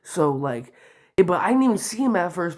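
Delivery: usual speaking voice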